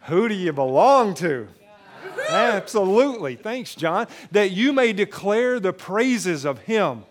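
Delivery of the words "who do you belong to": loud, emphatic preacher's challenge; with conviction. Shown as a demonstration. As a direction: ring it out, leaning hard on "belong". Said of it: "Say it like you have some conviction".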